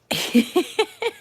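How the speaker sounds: high-pitched rapid giggle. Real, not acted. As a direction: giggle helplessly, cracked up. Cracked up by someone watching.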